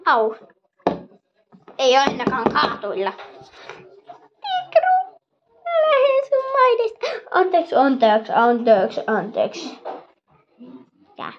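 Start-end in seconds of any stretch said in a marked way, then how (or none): 4.4-7.8 squeaky little creature huffing off; high toy voice. Pretend. Little toy leaving Tikru's land, high and squeaky. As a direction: pipe it high, leaving in a huff.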